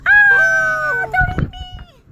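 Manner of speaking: high squeaky panicked cry; squeaky chicken scream. Acted. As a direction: shriek it like a frightened toy chicken. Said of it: "is going".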